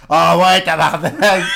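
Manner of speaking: loud, hammed-up Québécois swearing; accent québécois très épais, exagéré; thick Québécois accent. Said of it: "the thickest accent".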